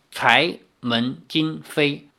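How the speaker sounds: each word set apart slowly; slow, deliberate enunciation. Shown as a demonstration. Repeats term slowly before explaining it. (一字一顿地慢慢念)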